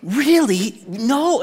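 high, gushing chatty voice; girls chatting on the phone. Retold or imitated. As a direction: mimic girls gasping on the phone. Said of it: "how they're like".

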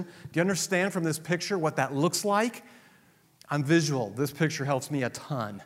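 ordinary talk

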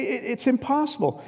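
high, exasperated protest, up by a lot; exasperated listener. Retold or imitated. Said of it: "And they say".

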